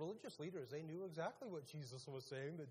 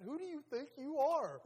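loud, high mock-outraged yell; acted confrontation; 11 semitones higher, 11 dB louder than usual. Preacher mimics someone challenging a person, loud mock outrage. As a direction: fire it out as a hammed-up challenge.

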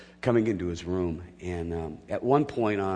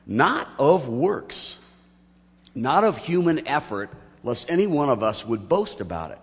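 louder, higher, building pulpit delivery; preaching at full force; 4 semitones higher, 5 dB louder than usual. Acted. Pastor driving home grace to his congregation, full preaching voice.

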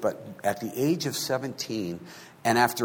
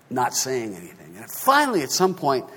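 ordinary talk

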